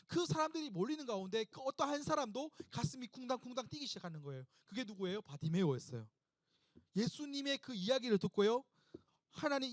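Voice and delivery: usual speaking voice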